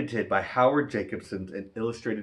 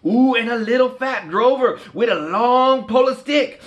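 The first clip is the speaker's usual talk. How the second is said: put-on higher, louder Cajun storyteller voice for the kids; Cajun story reading; 10 dB louder than usual. Reading a Cajun Christmas book to kids, higher, louder Cajun storyteller voice. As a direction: spin the Cajun tale with lively bayou flair.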